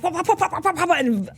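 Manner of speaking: rapid whooping like spinning helicopter blades; helicopter imitation. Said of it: "like, a helicopter".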